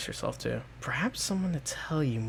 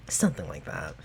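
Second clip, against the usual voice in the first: low, dry, knowing understatement; coy low reply; 7 semitones lower than usual. Acted. Verena dodging the creature's question, low and sly. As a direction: let it drop low and sly.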